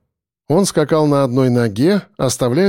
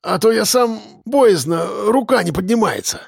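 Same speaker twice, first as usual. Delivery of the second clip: higher-pitched wincing voice; pained pleading; 6 semitones higher than usual. A narrator's reading. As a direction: plead as the injury hurts. Injured policeman begging for help. (жалобно, превозмогая боль)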